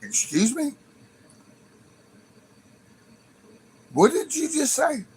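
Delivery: high, sharp disbelief; incredulous. Retold or imitated. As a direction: react like you misheard something outrageous. Stunned at the donation request.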